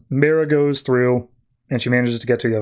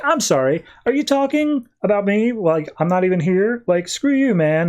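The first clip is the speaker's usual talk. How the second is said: offended outburst; 7 semitones higher than usual. Retold or imitated. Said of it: "And Mara's just like".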